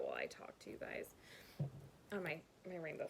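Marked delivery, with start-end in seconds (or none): 0.0-1.2 whispering. Real, not acted. Starts knitting while talking.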